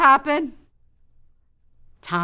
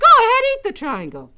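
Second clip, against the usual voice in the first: high, loud, quick order; raised-voice motherly command; insistent mom; 14 semitones higher, 7 dB louder, faster than usual. Acted. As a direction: urge the child briskly. Mother insists a second time.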